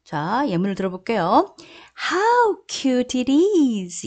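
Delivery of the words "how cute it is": slow, higher gushing exclamation; exclaiming. Shown as a demonstration. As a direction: coo it like something adorable. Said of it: "이렇게 발음하십니다". (귀여워하며 감탄하듯)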